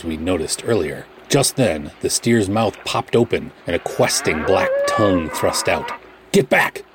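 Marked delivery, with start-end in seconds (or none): 6.3-6.8 high-pitched urgent shout; sudden high warning cry. A narrator's reading. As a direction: shout them back from danger. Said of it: "I shouted".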